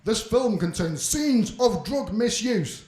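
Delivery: slow, loud, formal warning voice; solemn on-screen warning read; warning announcer. Shown as a demonstration. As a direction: read it like an official disclaimer.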